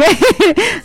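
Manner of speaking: quick high giggle; real giggle; short laugh. Real, not acted. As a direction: let a giggle slip out. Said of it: "Van a disculpar que yo me ría así".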